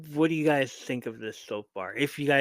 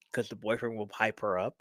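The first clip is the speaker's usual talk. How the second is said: normal talking voice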